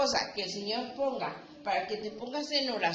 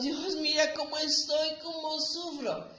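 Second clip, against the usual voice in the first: higher, imitating a weepy prayer; a touch of a mock sob; mock crying plea; 4 semitones higher than usual. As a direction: ham up a self-pitying lament to God. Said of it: "que llorando ante Dios".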